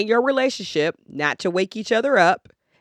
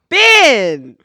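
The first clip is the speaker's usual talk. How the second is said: sudden loud, higher-pitched yell; 7 semitones higher, 11 dB louder than usual. Real, not acted. Cutting off co-host Ben, yelling his name. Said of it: "I'm sorry to yell into your microphone".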